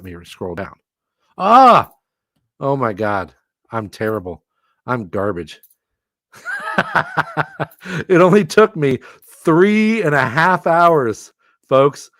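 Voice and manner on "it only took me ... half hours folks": laughing. Real, not acted. Laughing at own mistake.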